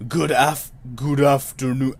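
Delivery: fumbled booming character voice, not soft; attempted quiet thunder. Reader retrying the clerk's greeting, put-on thunder voice. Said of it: "do a voice like quiet thunder".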